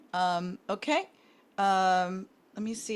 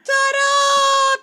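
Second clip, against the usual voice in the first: high, loud sing-song fanfare; triumphant ta-da; 9 dB louder than usual. Real, not acted. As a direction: announce the win with a flourish. Celebrating the correct guess.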